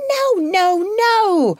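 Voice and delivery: high loud despairing no; cry of despair. A narrator's reading. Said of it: "cried Missus Ruggles in despair".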